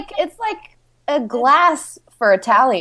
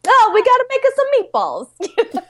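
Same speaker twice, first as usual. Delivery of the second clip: put-on high, fast Italian-American accent; quick Sopranos-style Italian accent; 13 semitones higher, much faster than usual. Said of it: "making me feel real Italian".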